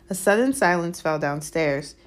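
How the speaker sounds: ordinary delivery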